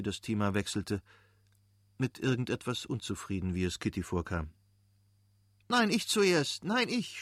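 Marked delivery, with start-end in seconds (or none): 5.7-7.2 put-on high-pitched children clamouring to go first; schreiende Kinder. A narrator's reading. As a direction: cry out like excited, competing kids. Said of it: "schrien die Kinder". (hohes, aufgeregtes Kindergeschrei)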